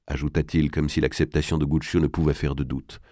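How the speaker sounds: ordinary delivery, nothing marked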